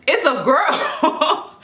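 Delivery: high, drawn-out echo in disbelief; freaked-out shock. Retold or imitated. Mother hears the intercom announce a girl, freaked out. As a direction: act out a stunned, stretched repeat. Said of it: "I'm, like, freaking out".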